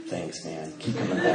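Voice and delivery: very soft, low guru calm; put-on spiritual. Young guru replying to an admirer, mock spiritual hush. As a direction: answer humbly, almost under the breath. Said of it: "supposed to talk like this is spiritual".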